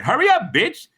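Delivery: high-pitched impatient call; impatient heckling. Retold or imitated. Student behind him at the water fountain, impatiently heckling. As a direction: imitate someone hassling from behind.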